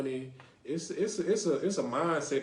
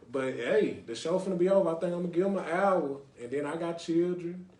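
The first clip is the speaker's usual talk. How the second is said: normal talking voice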